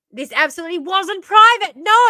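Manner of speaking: loud indignant no; emphatic. Real, not acted. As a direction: hammer the point home. Heated rant at politician.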